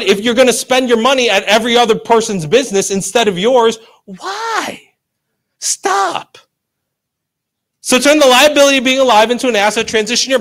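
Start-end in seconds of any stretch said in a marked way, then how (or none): 4.1-6.4 high, thin, softer whine; pained little squeal of protest; mock despair. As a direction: plead in a small high voice. Mock despair at wasted spending.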